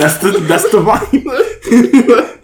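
slow, high, loud laughing words. Real, not acted. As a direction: talk through a big laugh. Laughing at the joke.

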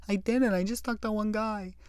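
high, meek little voice defending himself; sheepish excuse. Retold or imitated. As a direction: plead innocence like a caught kid. His own sheepish excuse, retold.

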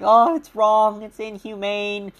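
mocking whiny complainer. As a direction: sneer it out as a hammed-up protester.

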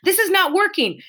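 high-pitched, exasperated call for help; mock yell. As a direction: call out to a partner in hammed-up frustration. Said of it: "then you yell to your whomever".

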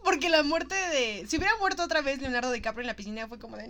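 ordinary delivery

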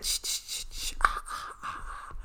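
eerie whispered shushing; Michael Myers whisper. Retold or imitated. Retelling the choking scene, whispered like Michael Myers. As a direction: hush them like a killer in the dark. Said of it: "like Michael Myers said".

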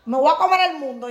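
cocky mock pep talk to yourself; mock boastful self. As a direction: brag like you'll conquer the world. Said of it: "uno se levanta por el día".

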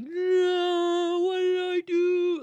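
high, loud, wailing sob-voice; retold crying. Mother retelling her breakdown at school, high sobbing wail. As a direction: re-enact breaking down in tears. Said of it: "I couldn't stop crying".

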